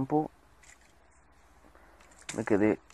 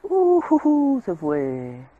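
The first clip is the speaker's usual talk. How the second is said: excited whoop; 9 semitones higher, 11 dB louder, much slower than usual. Real, not acted. Mob knocked away, gleeful.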